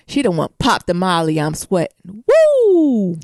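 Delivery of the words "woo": loud high woo like someone high; hammed-up party cheer; mock party whoop. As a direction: whoop it up, imitating the roommate on Molly. Acting out roommate on Molly.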